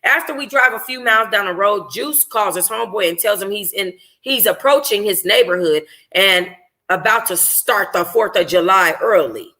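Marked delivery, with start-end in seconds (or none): none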